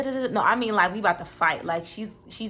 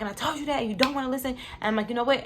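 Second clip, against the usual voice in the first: retold shouting; 6 semitones higher, faster than usual.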